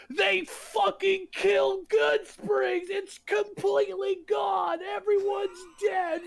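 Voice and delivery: high-pitched, drawn-out yelling outrage; furious rant. Real, not acted. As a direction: scream your frustration at the game. Ranting about game's ending.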